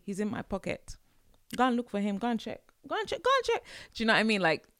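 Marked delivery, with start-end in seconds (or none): 0.0-3.6 high-pitched mock urging, climbing higher; sarcastic parent. Mum answering where daddy is, mounting high-pitched sarcasm. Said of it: "And they're being sarcastic".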